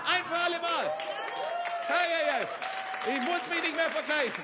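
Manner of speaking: loud, triumphant cry from the pulpit; shouted declaration of freedom; preaching shout. Acted. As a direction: proclaim it to the whole room.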